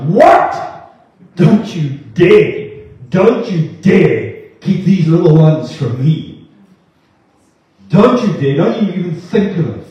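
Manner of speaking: loud outrage, each warning slowed down; angry. Acted. Jesus rebuking disciples who keep children away, angry. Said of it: "he was angry. He was like".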